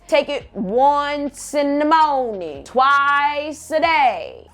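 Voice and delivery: very slow, spelled-out label reading. Retold or imitated. Reading the pill label slowly to people who won't read it. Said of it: "That shit fucking says".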